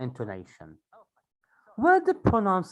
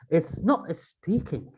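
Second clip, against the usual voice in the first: ordinary delivery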